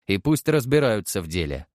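ordinary delivery

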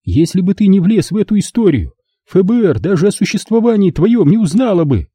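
high, upset mother's voice; scolding mother. Acted. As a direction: lay into him as his mother. Mother scolding her son. (мать отчитывает сына, высоким голосом)

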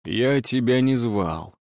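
slow, drowsy husband; groggy, dragging reply; sleepy mumble. A narrator's reading. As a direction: mumble it half-asleep, drawn out. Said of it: "сонно пробормотал муж".

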